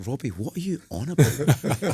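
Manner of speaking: drawn-out disbelief at nonsense; mock bafflement. Baffled by the scorpion fable.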